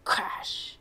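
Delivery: breathy whisper of a crash. Acted. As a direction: hush the sound effect. Sound effect in story.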